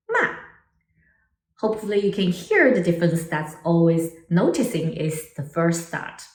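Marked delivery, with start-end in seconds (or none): 0.1-0.3 sharp falling "ma"; short, steep drop on "ma"; fourth tone. Shown as a demonstration. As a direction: say "ma" like a decisive command. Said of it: "recap these four tones".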